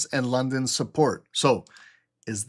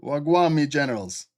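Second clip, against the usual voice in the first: put-on playful Jamaican accent; 3 dB louder than usual. Streamer greets his chat in a mock Jamaican accent. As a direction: lay on a light Jamaican accent, a bit louder. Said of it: "How's the Jamaican accent getting better?".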